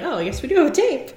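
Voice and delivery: a quick, high "oh right" of mock discovery; mock surprise. Mock realisation caught on tape.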